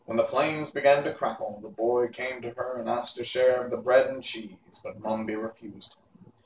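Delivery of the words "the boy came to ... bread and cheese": ordinary delivery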